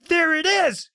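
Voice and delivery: terrified cry. Acted. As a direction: cry out in sudden terror. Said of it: "he cried".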